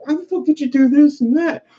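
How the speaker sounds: angry boss. Retold or imitated. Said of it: "My boss came in like".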